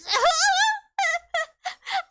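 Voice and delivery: high-pitched squealing laugh; loud yelp breaking into laughter; real laugh. Real, not acted. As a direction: burst into high, helpless giggles. Laughs at own overrated remark.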